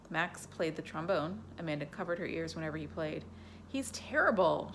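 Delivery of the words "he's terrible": shouting complaint. Acted. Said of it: "she would shout to her father".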